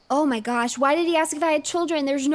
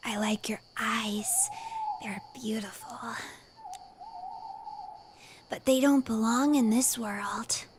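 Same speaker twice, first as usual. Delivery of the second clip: put-on creepy kid whisper; 6 dB quieter than usual. Creepy boy whispering to the player about her eyes. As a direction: breathe it like an unsettling little boy.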